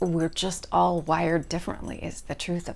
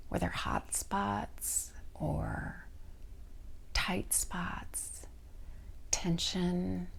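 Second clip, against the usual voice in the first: guided meditation. Real, not acted. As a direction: lead them gently down the body, stretching each part's name. Leads a guided body scan.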